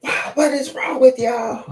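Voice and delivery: high, slow mock outburst; hammed-up exasperated yell at the dog; going off. Owner going off at her dog, hammed-up yelling. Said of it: "I be going off".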